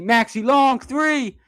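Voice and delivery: rising, eager play-by-play; anticipating the shot. Real, not acted. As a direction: pitch climbing high into the long three. Calling a long shot attempt.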